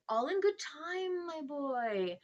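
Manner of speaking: loud, drawn-out brush-off; impatient rebuke. Acted. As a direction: cut in and put the boy off. Uncle brushes off impatient boy.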